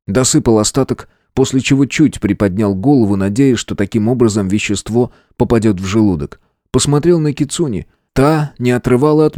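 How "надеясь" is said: ordinary delivery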